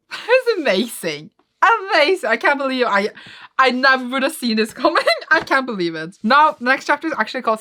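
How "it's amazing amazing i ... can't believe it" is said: high, loud, laughing disbelief; amazed. Real, not acted. Reader stunned that Guts and Zod teamed up, amazed. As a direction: gush in astonishment, voice lifted. Reacting amazed to the manga fight.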